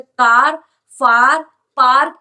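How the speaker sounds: teacher modelling a vowel; long aa sound. Shown as a demonstration. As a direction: lean on the long aa in "फार". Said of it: "ये आ की आवाज निकाल रहा है".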